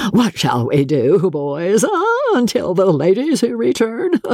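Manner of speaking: high hearty dandy with a laugh; jolly dandy voice. A narrator's reading. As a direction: ask the boys jovially. Joss asks his friends.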